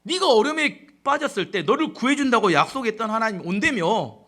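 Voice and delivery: loud, jeering demand; acted-out accusing challenge; mocking taunt. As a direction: taunt them like a scornful enemy. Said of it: "이렇게 조롱하고 비난합니다".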